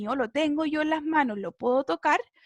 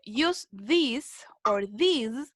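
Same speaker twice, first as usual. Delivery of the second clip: slow, careful read-through; teacher reading a rule slowly; slow reading aloud; much slower than usual. Shown as a demonstration. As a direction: read it out word by word for the class. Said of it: "vamos entonces a leer".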